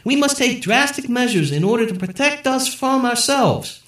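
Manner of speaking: high, loud statesman address; presidential speech. A narrator's reading. The president addresses the nation, high and loud. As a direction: deliver it like a TV president.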